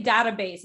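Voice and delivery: "database" said as DAT-uh-base; short-A database. Shown as a demonstration. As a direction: give "database" the vowel of "cat". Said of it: "Is it database or database".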